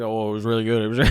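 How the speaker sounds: low, indistinct mumble; mumbling. Retold or imitated. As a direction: mumble it as if barely there. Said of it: "he's, like, mumbling".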